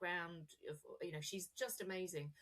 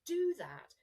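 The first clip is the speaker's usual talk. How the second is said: normal talking voice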